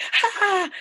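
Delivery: sudden high, loud yelp of fright; mock startled yelp; startled gasp. Shown as a demonstration. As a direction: jolt out a shocked cry. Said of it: "You're not like".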